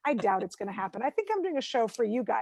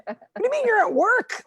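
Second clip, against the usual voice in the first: high, loud mock outrage; exasperated quarrel; 9 semitones higher, 5 dB louder than usual. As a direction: challenge your husband in loud comic disbelief. Acted quarrel with husband.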